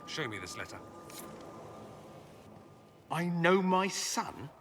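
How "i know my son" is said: slow, sneering echo of his father's words; mocking quote. Dorian mocks his father's letter to the Inquisitor, sneering slowly. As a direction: drag it out as a scornful quote.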